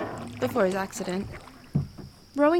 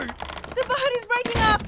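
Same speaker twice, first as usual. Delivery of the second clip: terrified; 8 semitones higher, slower than usual. Acted. Boat breaking apart.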